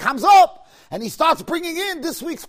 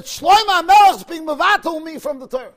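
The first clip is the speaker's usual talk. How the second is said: louder, higher protest; retold complaint; 4 semitones higher, 6 dB louder than usual. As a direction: voice the letter's loud complaint to God. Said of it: "and said".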